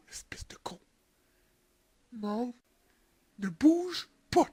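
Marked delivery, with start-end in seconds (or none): 0.1-2.5 urgent hushed whisper. Real, not acted. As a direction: hiss it so no one hears.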